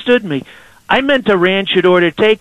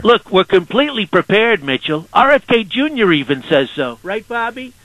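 Donald Trump impression; 3 semitones higher than usual. Trump calling in to radio hosts, swaggering and boastful. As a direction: sell every line like the president on a call-in.

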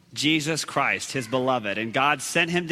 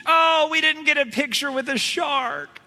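disappointed family; 9 semitones higher than usual. Retold or imitated. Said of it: "this family comes running".

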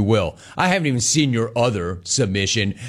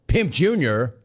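usual speaking voice

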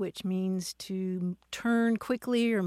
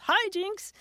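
mock yell; 10 semitones higher than usual. Host acts out somebody in a drinking game, mock yell. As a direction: imitate a drinking-game shout, pitched well up. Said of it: "somebody yells".

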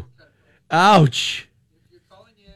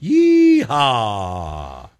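rodeo yeehaw. Real, not acted. Host's rodeo call.

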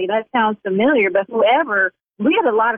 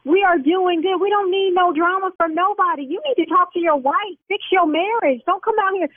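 higher-pitched indignant scolding; lecturing the caller, higher and indignant; angry scolding; 7 semitones higher than usual. Acted. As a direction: tell him off from higher up.

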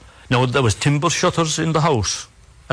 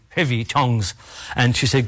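plain reading voice

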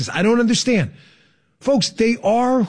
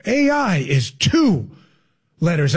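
mock-profound slow repeat, pause before LETTERS; drawn-out parody of a politician; Kamala Harris; 5 semitones lower, slower than usual. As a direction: say it at half speed as if that adds weight. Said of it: "If I say it slow".

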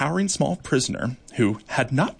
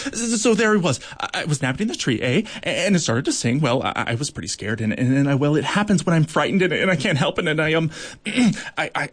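higher, babbling, stumbling over words; a jittery beetle confessing in a rush; nervous rambling beetle; 3 semitones higher than usual. Acted. Bombardier beetle nervously explaining to the court, babbling.

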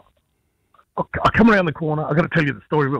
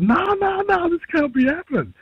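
high, overwhelmed no-no-no; disbelieving protest. Retold or imitated. Running off in tears after winning, disbelieving protest. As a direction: retell stunned disbelief, pitched right up.

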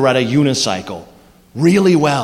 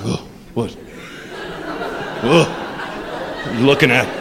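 wobble and grunt while balancing; straining grunts; 5 semitones lower, 7 dB quieter than usual. Retold or imitated. Comic playing a tough guy on a unicycle fighting for balance, low strained grumble.

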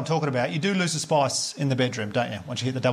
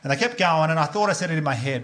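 ordinary talk, nothing marked

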